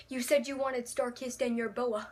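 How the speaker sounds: ordinary talk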